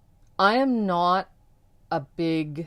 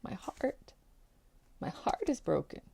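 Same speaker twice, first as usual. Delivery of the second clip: hushed tearful protest; 4 semitones higher, 10 dB quieter than usual. Retold or imitated. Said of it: "And I said".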